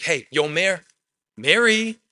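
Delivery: breezy call, rising high on Mary; lightly voiced Jesus calling her casually. Jesus casually calling out to Mary, rising high. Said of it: "Maybe it was like".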